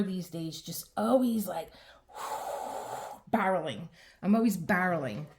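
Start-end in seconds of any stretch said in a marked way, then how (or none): 2.1-3.3 long, breathy sigh; weary sigh. Real, not acted. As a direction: let out a tired sigh. Feeling rushed, barreling.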